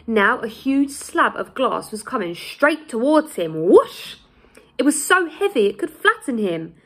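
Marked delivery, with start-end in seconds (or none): 3.7-4.1 whoosh sound effect. A narrator's reading. Glass slab swooshing toward him.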